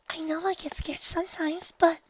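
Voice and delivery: very high, small timid voice; whimpering. Acted. Fluttershy admitting fear, high small whimper. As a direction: shrink as you say it. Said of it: "Fluttershy whimpered".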